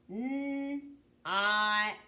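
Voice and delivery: held "N", pause, drawn-out "ought"; sounded out. Shown as a demonstration. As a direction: separate "N" from "ought". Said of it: "Tell me the sounds we hear in not".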